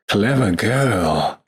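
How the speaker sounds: slow, drawn-out movie-line imitation; Jurassic Park quote. Quoting Jurassic Park to a co-host, drawn-out imitation. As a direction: stretch out the famous film line. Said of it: "Jurassic Park?".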